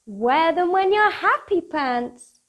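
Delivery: usual speaking voice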